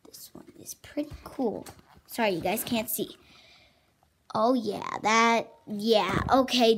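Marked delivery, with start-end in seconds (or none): 0.1-1.7 whisper. Acted. Whispered show-and-tell.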